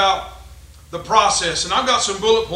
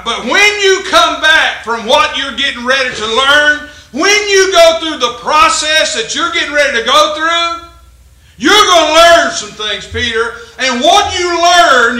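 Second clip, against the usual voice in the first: loud, higher, slow pulpit shout; preaching shout; 6 semitones higher, 8 dB louder, slower than usual. Acted. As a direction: build to a rousing shout.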